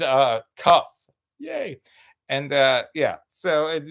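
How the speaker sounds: ordinary talk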